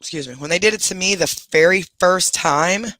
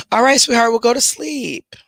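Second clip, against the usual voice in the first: soothing pet talk; 7 semitones higher, 4 dB louder than usual. Real, not acted. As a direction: coax the pets gently toward sleep. Settling her pets for bed.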